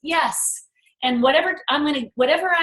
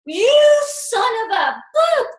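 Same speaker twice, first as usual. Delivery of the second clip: high, slow, loud "son of a boop" at the doubt; a mock curse at the doubt; defiant outburst; 12 semitones higher, 4 dB louder, slower than usual. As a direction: tell the inner critic off with full force. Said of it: "I'm like".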